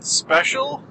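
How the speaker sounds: "special" rising high like an unsure question. Shown as a demonstration. Host doubting his own special edition, questioning rise on "special". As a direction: lift "special" as if in doubtful quote marks. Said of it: "in quotes, with a question mark on the end".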